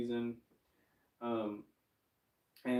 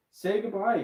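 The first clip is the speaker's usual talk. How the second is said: high, loud cue to the puppet; loud prompt; 10 semitones higher, 9 dB louder than usual. Acted. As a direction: call out to the dragon puppet. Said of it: "We'll do some ventriloquism".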